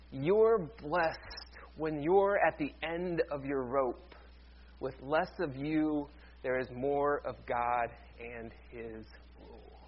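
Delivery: slow, hushed scripture reading; solemn reading. Acted. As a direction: read it slowly and reverently. Reading scripture paraphrase slowly.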